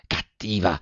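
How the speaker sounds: hushed, embarrassed little scolding; murmured reproach. A narrator's reading. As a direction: murmur it shyly with head bowed. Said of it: "mormorò Velleda chinando il capo".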